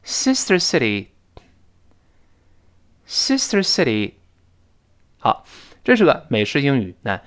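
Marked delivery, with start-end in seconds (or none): 0.0-4.1 "sister city" pronounced crisply for repetition; careful teacher reading of "sister city"; read-aloud model. Shown as a demonstration. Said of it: "我们跟老师大声读一遍".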